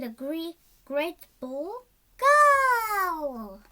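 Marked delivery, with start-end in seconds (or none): 2.2-3.6 a loud, high cheer; excited little shout from the side; playful shout. A narrator's reading. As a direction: call it out like starting a game. Playing ball with pea.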